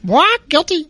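squeaky parakeet juror. Pretend. Mock trial of talking birds.

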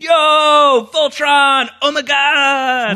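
put-on high loud kid yell; thrilled kid with no accent; excited kid. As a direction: shout it like a kid with a new toy. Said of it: "he's just like".

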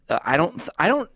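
usual speaking voice

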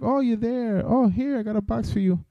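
catty FedEx guy. Retold or imitated. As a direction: camp it up as the catty driver caught out. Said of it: "and he'll be like".

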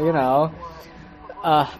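normal talking voice

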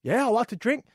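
high, hesitant put-on voice; reluctant bloke. Hermit bloke hedging to the cowboy, high put-on voice. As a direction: hedge politely, wanting to be left alone. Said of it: "The guy goes".

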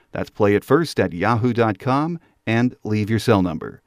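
usual speaking voice

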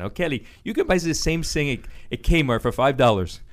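put-on a mild take on mother's German accent, th sounded as s; imitating mom's German accent. Son quoting his thrifty mother in a light German accent. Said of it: "She always said it in a German accent".

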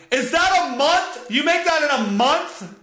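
high, slow disbelieving question; shocked clerk. Retold or imitated. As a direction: ape her stunned disbelief, rising up high. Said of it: "she goes".

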